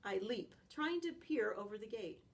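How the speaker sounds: plain reading voice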